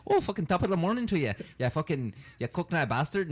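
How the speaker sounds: high, loud, fast Irish shout; mock Irish accent. Showing off his Irish to a stranger, loud mock Irish accent. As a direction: burst into a hammed-up Irish greeting. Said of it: "check my fucking Irish out".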